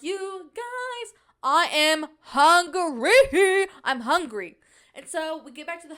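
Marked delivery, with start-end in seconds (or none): 0.0-4.6 whiny hungry complaint. Retold or imitated. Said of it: "and I'm like".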